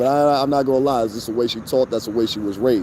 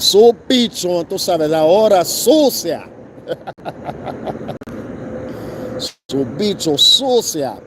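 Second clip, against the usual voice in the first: mock Spanish; 4 semitones higher than usual. Mocking tourists back home, slow broken Spanish. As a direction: drawl fake Spanish words.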